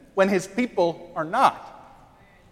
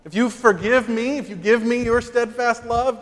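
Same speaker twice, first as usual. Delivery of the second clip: ordinary talk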